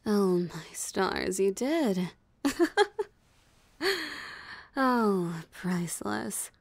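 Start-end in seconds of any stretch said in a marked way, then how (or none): 2.4-3.0 high, loud burst of laughter; mocking laugh. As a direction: laugh at the hunter's blunder. Vampire amused by forgotten cross.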